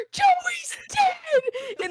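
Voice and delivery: gasp, then high-pitched mock shriek; hammed-up cry of horror, laughter underneath; mock scream. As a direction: imitate a horrified friend screaming. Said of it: "screaming bloody murder".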